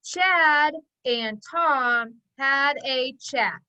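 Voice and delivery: Chad and Tom had a chat, each word beaten out slowly; slow pounding so children join in. Shown as a demonstration. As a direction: tap out every word with the class. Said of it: "Pound it with me".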